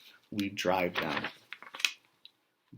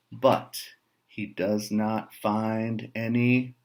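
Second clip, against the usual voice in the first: plain reading voice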